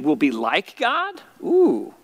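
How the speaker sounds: intrigued wonder. Acted. Adam and Eve mulling the serpent's offer, intrigued. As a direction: marvel at a tempting offer. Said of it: "they're like, "Okay, we've been".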